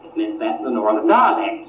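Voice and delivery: normal talking voice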